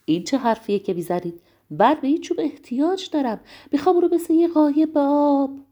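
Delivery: taken-aback, increasingly urgent storybook voice; astonished. A narrator's reading. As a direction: protest with mounting insistence. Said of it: "قورباغه با تعجب پرسید".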